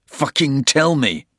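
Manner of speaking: loud, high furious command; furious shout. A narrator's reading. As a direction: yell the order at him. Porter shakes the Russian.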